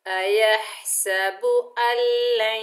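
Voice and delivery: slow, drawn-out chanted recitation; Quran recitation. Shown as a demonstration. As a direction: recite it slowly with tajweed. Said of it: "मिलाकर पढ़ते हैं".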